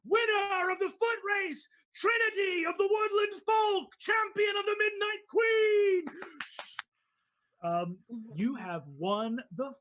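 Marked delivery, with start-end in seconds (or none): 0.1-6.1 crowd cheering. Acted. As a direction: call out the champion to the stands. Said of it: "you see people go".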